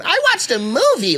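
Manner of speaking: lightly voiced girlfriend, high and sweet; imitating girlfriend. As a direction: chirp it back innocently. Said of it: "Fuck you doing?".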